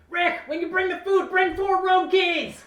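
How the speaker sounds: shrill high yell; piercing shout. Acted. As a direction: shout back into the kitchen. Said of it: "she shouts back into the kitchen".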